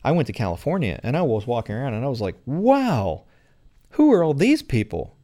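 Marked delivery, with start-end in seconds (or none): 2.5-5.1 high, louder wide-eyed wonder; amazed. Retold or imitated. Newcomer walking around California, high amazed exclaim. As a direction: gasp it out, taken aback by the crowd.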